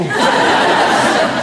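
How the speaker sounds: unvoiced burst of laughter; airy laugh; breathy laugh. Real, not acted. Host laughs at joke.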